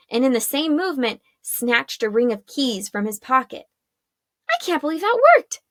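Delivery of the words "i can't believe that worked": high, fast, disbelieving inner voice; a quick, high gasp of disbelief; Arella's thought. Acted. Arella, amazed her trick worked, high and fast. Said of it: "she thought".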